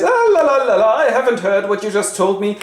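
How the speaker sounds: hammed-up fingers-in-ears singsong; mock la-la-la. Friar covers his ears.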